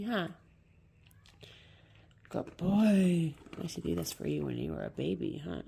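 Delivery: ordinary delivery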